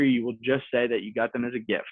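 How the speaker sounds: ordinary talk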